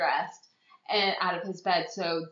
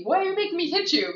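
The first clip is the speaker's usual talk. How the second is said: high, faster guilt-tripping; mock manipulative whine; 9 semitones higher, 5 dB louder, faster than usual. Friend imitating the abusive boyfriend's guilt trip, high. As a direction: twist the blame onto her.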